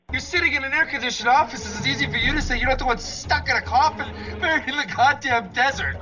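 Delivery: bitter high-strung outburst. Acted. Bitter at the calm operator.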